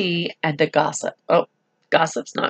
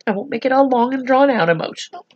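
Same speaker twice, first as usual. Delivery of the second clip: ordinary delivery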